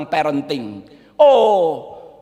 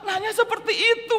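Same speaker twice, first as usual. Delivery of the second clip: high amused laugh running into the words; laughing while speaking, pitched up; 12 semitones higher than usual. Real, not acted. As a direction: chuckle in delight at the question. Preacher laughs at Jesus' question.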